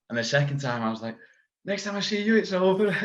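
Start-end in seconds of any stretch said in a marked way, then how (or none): 1.6-2.9 much higher, cocky and eager; giddy. Retold or imitated. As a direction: sing it out up high, sure he's nearly done. Telling the coach between rounds.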